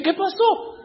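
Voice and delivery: shocked high little cry; startled squeak. Retold or imitated. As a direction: caught off guard by your own hand. Reacting to hand rising.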